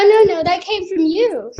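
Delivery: usual speaking voice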